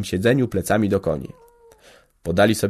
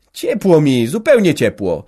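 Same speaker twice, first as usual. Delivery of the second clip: put-on higher, louder insisting boy; reassuring boy; 6 semitones higher, 6 dB louder than usual. A narrator's reading. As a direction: brush off mother's fuss. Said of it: "zapewniał".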